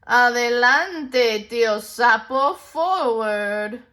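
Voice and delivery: slow, loud taunting call; calling back. Acted. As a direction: tease him over your shoulder. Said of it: "called back".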